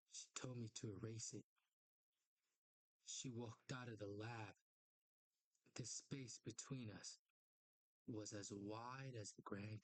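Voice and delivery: low, measured dramatic read; comic narration. Acted. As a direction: narrate gravely, leaving pauses between phrases. Reads comic narration aloud.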